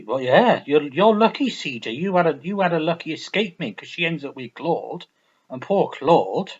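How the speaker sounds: usual speaking voice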